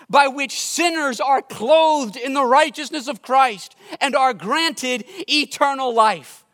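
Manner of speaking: high, loud, emphatic gospel appeal; impassioned preaching. Real, not acted. Preacher urging the congregation to go to Christ, impassioned. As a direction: preach it out with full conviction.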